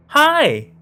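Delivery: high, loud, chirpy "Hi!"; bubbly feminine greeting. Acted. An eerie voice greeting the narrator from nowhere, bubbly and high. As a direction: chirp it like a bright young woman. The greeting came from further away.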